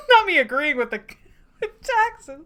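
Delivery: loud, high, amused aside; laughing. Real, not acted. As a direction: break up laughing mid-sentence. Reader laughs at own agreement.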